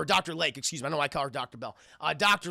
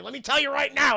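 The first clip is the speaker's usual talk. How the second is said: higher, louder stern villain warning; mock head-gesture warning; 8 semitones higher, 5 dB louder than usual. Streamer acting out Freeze gesturing with his head: mock stern. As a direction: jab the words with your head. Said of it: "as I'm gesturing with my head".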